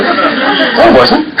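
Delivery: high put-on voice of a calm passenger, whispered no then laughing; high playful denial of the boat passenger; mock innocent denial. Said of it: "He goes".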